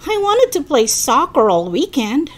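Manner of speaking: high, loud indignant outburst; yelled protest. A narrator's reading. Eddie wanted soccer all weekend, yelling in protest. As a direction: protest loudly with pitch jumping up. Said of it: "Eddie yelled".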